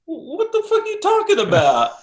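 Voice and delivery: high, loud indignant outburst; outraged disbelief. Retold or imitated. Engineer told his snare is wrong, outraged. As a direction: explode in shocked protest. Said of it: "I'm like".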